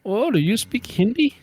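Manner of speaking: high-pitched imitation of an Indian accent; mock Indian accent. Caller asking the prankster about Hindi, high mock Indian accent. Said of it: "and he's like".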